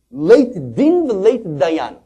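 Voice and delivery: high, loud, desperate cry; anguished outcry. Retold or imitated. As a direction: fling the words out in anguish. Rebbe's outcry retold.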